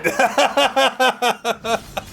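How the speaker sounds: short disbelieving laugh at the lag; exasperated chuckle. Real, not acted. Stream lag frustration.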